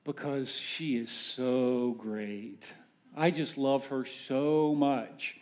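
slow, soft lovestruck reply; a lightly mocked moony groom; dreamy answer. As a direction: gush it slowly like a smitten fiancé. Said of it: "the response is usually something like".

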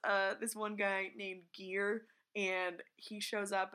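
ordinary delivery

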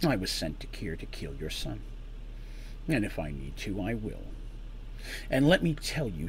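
detached, matter-of-fact menace; cool and cold. Acted. Man admits to mother he'll kill her son, coolly. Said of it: "he replied coolly".

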